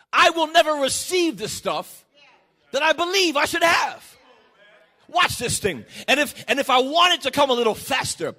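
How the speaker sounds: loud, high, driving sermon climax; full-force preaching. Acted. Preacher rousing the congregation to put work on faith, shouting. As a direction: preach it out with everything you've got.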